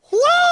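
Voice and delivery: loud, very high sustained whoop; imitated war whoop. Preacher imitating a little old lady shouting in church, war whoop. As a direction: let out a piercing mock war whoop. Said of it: "she let out a war whoop".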